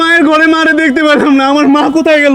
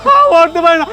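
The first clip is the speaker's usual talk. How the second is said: crying denial; 6 semitones higher than usual. Acted. Son at mother's grave, sobbing denial. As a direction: break down crying, refuse to believe.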